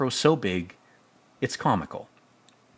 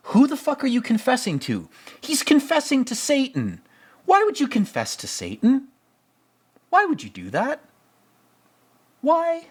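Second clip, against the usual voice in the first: incredulous rant. Real, not acted. Incredulous rant at confessing to Satan.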